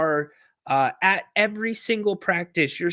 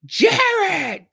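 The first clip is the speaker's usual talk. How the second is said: high, loud shout of a name across the gym; coach's yell; 6 dB louder than usual. Retold or imitated. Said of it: "And he goes".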